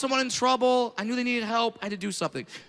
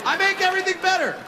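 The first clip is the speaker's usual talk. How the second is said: high, fast proud proclamation; proud catchphrase; 10 semitones higher, 3 dB louder, faster than usual. Acted. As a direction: sell the catchphrase big. Catchphrase punchline.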